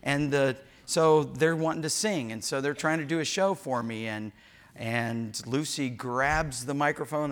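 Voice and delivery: ordinary talk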